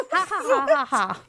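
put-on childish cackling laugh, high at first; kiddie cackle. Podcaster acting out Wendy's laugh, childish cackle. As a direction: cackle like a kid. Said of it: "cackles with a kiddie laugh".

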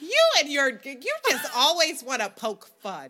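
high, loud scolding mom voice; imitating mom. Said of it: "She'd be like".

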